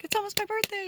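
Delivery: very high-pitched gleeful squeal; retold giddy excitement. Quoting oneself about an upcoming birthday, giddy high squeal.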